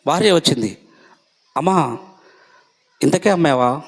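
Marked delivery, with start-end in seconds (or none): 1.6-3.8 questioning apostle. Acted.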